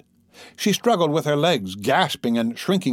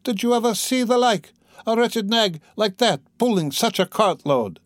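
high, incredulous onlooker; exclaiming; 9 semitones higher than usual. A narrator's reading. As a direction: marvel aloud at a high pitch, easing down. Crowd member exclaims at the sight.

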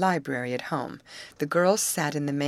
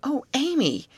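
high, pleading protest; protesting cry; 12 semitones higher, 4 dB louder than usual. A narrator's reading. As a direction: protest in chorus. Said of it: "It was a protesting chorus".

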